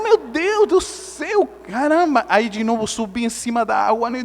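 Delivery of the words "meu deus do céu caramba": high, drawn-out mock dismay; hammed-up high-pitched alarm; retold desperate exclamation. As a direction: cry out slowly to heaven in comic panic. Retold drowning panic.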